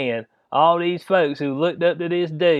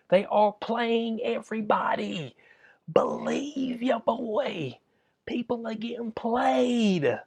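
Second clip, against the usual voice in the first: slow, higher whisper, like sharing a secret; conspiratorial whisper; 6 semitones higher, slower than usual. Real, not acted. Vlogger confiding to viewers that everyone's being played, slow whisper. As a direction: whisper the warning slowly, leaning in.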